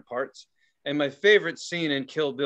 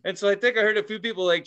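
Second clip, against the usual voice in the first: ordinary talk, nothing marked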